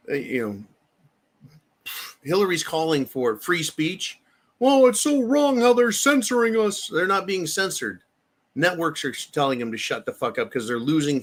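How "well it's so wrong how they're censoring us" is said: high, mocking whine of a complaint; sarcastic high mock voice; mocking whiny Hillary. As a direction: parody her fake outrage, pitched up.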